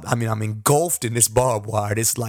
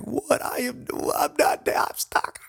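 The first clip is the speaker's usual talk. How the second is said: panicked reply. Retold or imitated.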